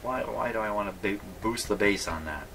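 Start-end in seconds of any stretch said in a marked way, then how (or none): none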